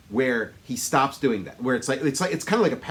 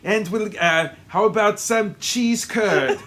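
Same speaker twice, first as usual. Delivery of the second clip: higher, louder mock Austrian accent; Schwarzenegger; 6 semitones higher, 5 dB louder than usual. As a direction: play it big with a comic Austrian accent. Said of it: "he talks the way he does".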